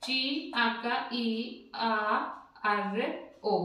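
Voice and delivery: slow letter by letter; spelled out slowly. Shown as a demonstration. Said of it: "तो वैसे ही". (धीरे धीरे अक्षर बोलना)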